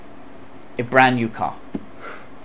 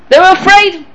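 usual speaking voice

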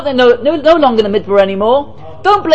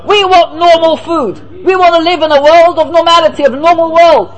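mock demanding people; 6 semitones higher than usual. People demanding normal food.

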